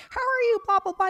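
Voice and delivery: cheery cabbie. Retold or imitated. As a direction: play the too-happy driver. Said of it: "he's like".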